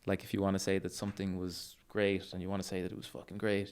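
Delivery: ordinary delivery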